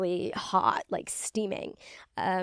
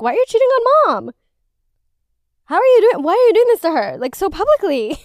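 high, loud hammed-up wail of betrayal; mock outrage; 10 semitones higher, 9 dB louder than usual. Viewer scolding friend's boyfriend on TV for cheating, mock-outraged yelling. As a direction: yell it like a scandalized child, much higher.

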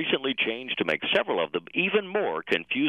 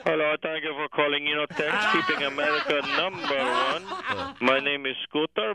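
call center rep; 4 semitones higher than usual. Acted. Bored call center rep Scooter.

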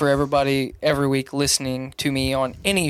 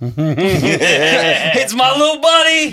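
slow, higher, laughing buddy voice; cute little buddy voice; 4 semitones higher, 6 dB louder, much slower than usual. Retold or imitated. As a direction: coo it slowly through a laugh. Voicing a cute buddy greeting.

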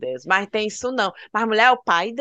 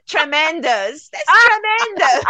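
put-on high, loud American accent; 6 semitones higher, 4 dB louder, slower than usual. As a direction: gush it out like an excited American kid. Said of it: "tudo com um sotaque americano".

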